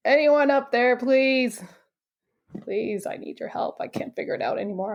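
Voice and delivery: acted desperate plea that fades away; retold begging. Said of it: "begged for help".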